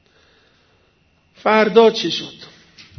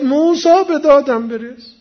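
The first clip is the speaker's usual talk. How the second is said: loud, raised mock shout for rescue; retold cry for help; 5 semitones higher, 6 dB louder than usual. The man shouts again to Moses for help, retold cry. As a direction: call out loudly, imitating a desperate plea. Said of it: "دوباره داد زد".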